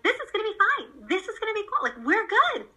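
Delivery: high, cheery we've-got-this pep talk; upbeat reassurance. Acted. Mom showing her hospitalized daughter it's fine, upbeat reassurance. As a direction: lift it high, acting relentlessly positive. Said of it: "I wanted my face to constantly be like".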